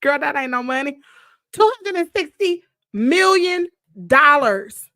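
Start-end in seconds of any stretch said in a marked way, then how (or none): 1.5-4.9 loud shocked emphasis. Real, not acted. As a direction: hammer home the sum. Stressing the shocking sum.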